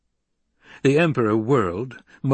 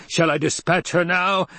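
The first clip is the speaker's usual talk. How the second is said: shout; 7 semitones higher than usual. A narrator's reading. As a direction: call out for the kill order. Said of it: "the Baron shouted".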